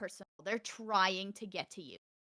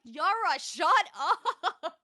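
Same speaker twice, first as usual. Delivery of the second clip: laughing scold; 7 semitones higher, 4 dB louder, much slower than usual. Retold or imitated. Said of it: "I'm like".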